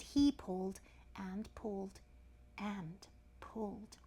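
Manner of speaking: slow, weighty, fading repeats; slow heavy pulling. A narrator's reading. Storyteller on the crocodile pulling, slow and laboured.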